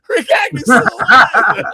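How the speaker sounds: high, loud words through real laughter; laughing. Real, not acted. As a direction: blurt it out cracking up. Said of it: "I'm like".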